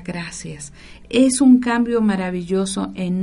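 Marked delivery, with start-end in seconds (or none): 0.0-0.7 soft, breathy-free whisper of gracias. Shown as a demonstration. Speaker shows listeners the word to use, whispered.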